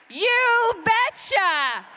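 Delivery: loud, high, drawn-out mocking cheer; very slow, shouted crowd chant; Tom Tiffany catchphrase. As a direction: belt out a borrowed catchphrase, stretching both words. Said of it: "as Tom Tiffany would say".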